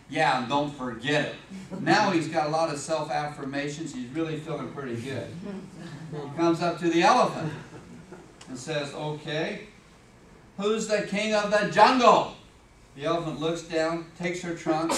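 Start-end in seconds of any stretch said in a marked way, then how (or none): 0.1-1.3 low, slow cocky warning; smug lion. Acted. Lion putting the giraffe in its place, smug. 8.9-12.4 loud, slow, boastful demand; boastful lion. Acted. Lion challenging the elephant, boastful and loud.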